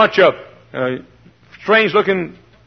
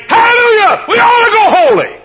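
much higher and louder, an ecstatic cry; joyful shout; 7 dB louder than usual. Acted. Preacher showing what the mockers should be shouting, ecstatic shout. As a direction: burst out in praise. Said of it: "You ought to be saying".

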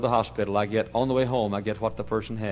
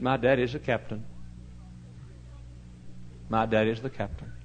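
calm assurance; 7 dB quieter than usual. Retold or imitated. Little boy answers the frightened man, calm soft assurance. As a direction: answer quietly, dropping right down after the shouting.

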